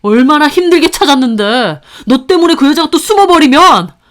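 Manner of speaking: high, loud furious rant; heated outburst. Acted. Husband raging at his wife over losing the ex-wife, loud and high. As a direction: blow up in frustration. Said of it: "하면서 열을 내더니".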